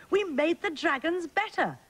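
very high triumphant announcement; high-pitched storybook cheer; excited yell. A narrator's reading. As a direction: proclaim the success in a high voice. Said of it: "they yelled".

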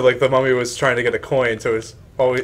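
ordinary talk, nothing marked

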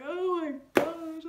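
high, slow tearful wail; a retold sob of disbelief; crying out. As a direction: wail it like you're starting to cry. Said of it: "So I started crying".

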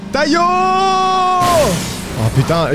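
high drawn-out excited shout. Real, not acted. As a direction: cry out in amazement. Surprised outburst while gaming.

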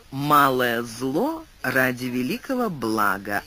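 plain reading voice

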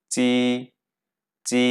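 tongue flat on the palate for চি; Mandarin ji sound. Shown as a demonstration. Teacher contrasts Chinese j with Bengali চ. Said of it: "জের উচ্চারণ পড়েছিলাম চয়ের মতো".

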